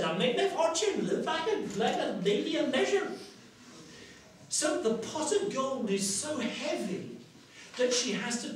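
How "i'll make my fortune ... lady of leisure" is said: put-on high, dreamy-eager old countrywoman; old woman. Washerwoman dreams of living like a lady, high old-woman glee. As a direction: relish the fortune in a high old voice. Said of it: "how old and worn out she is".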